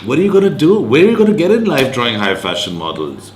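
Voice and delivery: high, loud scoffing teacher voice; incredulous teacher. Retold or imitated. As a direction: voice the doubting teacher, rising sharply. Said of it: "my English teacher came and said".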